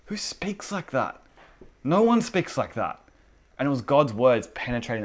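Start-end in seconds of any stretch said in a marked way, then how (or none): 0.1-3.0 high-pitched, incredulous disbelief; acting out astonished onlookers; stunned guards. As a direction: start hushed and high, then build in volume. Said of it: "the guards were so cut".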